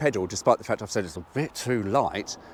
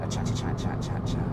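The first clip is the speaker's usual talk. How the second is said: whispered, teasing little singsong; playful whisper. Real, not acted. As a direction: murmur it under your breath, playfully. Playful aside while driving.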